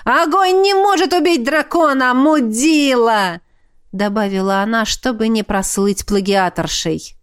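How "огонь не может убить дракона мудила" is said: triumphant yell. A narrator's reading. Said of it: "торжествующе завопила агент".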